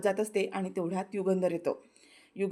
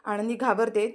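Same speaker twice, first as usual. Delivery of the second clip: ordinary delivery, nothing marked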